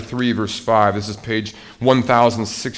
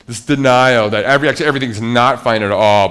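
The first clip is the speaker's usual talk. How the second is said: louder, driving the point home; emphatic preaching; 8 dB louder than usual. Real, not acted. Preacher stressing denial to the congregation, loud. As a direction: push hard on the denial.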